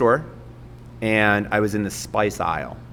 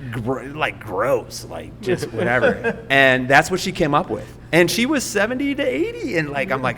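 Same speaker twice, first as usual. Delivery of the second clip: ordinary talk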